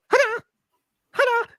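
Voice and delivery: high squealed mock Bruce Lee kiai; mock kung fu yell. Retelling the trailer to a friend, a high squealed mock yell. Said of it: "it's just him going".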